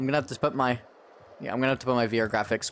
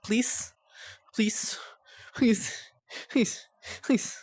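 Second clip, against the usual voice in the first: desperate begging; 8 semitones higher, much slower than usual. Real, not acted. Begging the game to load.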